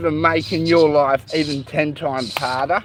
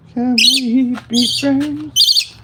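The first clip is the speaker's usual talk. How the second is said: slow, higher coaxing voice; sweet-talking the bird; 7 semitones higher, much slower than usual. Real, not acted. Owner making peace with his bitten-off bird: slow high coaxing.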